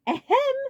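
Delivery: insistent ahem. A narrator's reading. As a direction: demand attention harder. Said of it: "Ludwig said again".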